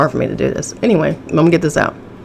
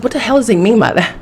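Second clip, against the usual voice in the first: offended friend; 6 semitones higher, 3 dB louder, faster than usual. Retold or imitated. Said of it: "she's like".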